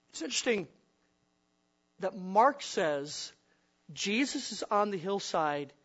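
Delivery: ordinary talk, nothing marked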